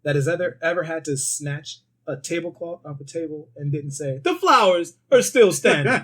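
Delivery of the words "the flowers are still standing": Bill Murray quote. Retold or imitated. Quoting Bill Murray's movie line.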